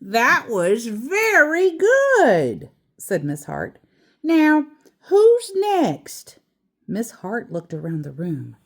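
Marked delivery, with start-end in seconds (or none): 0.0-2.7 higher, slow praising teacher; teacher praise. A narrator's reading. 4.2-6.4 much higher, louder teacher voice; teacher calling on class. A narrator's reading. Teacher calls next pupil.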